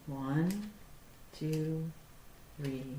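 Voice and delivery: ordinary talk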